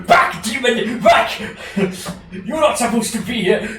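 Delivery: high, loud frantic yelling; frantic shout. Acted. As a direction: drive the demon back at full voice. Farazan shouting at intruder.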